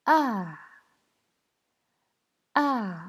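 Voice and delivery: "A" starting high and loud, falling sharply; fourth tone. Shown as a demonstration. Teacher demonstrating Mandarin tones, "A" forcefully falling. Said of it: "ça descend".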